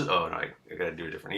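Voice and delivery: ordinary talk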